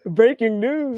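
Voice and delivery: high, loud breaking news call; news anchor voice. Acted. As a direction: announce it like a TV anchor. Mock news announcer shout.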